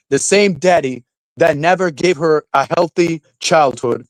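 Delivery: usual speaking voice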